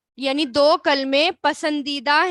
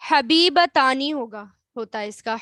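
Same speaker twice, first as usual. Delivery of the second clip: ordinary delivery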